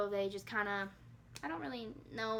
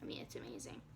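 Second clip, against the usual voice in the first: hushed, fading into a whisper; very soft, overcome with real emotion; moved near tears; 15 dB quieter than usual. Real, not acted. Mother thinking about her newborn pictures, moved near tears. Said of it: "I wanna cry thinking about it".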